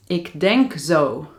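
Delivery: usual speaking voice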